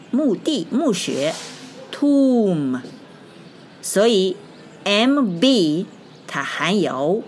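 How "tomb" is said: tomb higher and drawn out; tomb with no b at the end; silent B. Shown as a demonstration. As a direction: hold tomb into a closed M. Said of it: "但是B不发音".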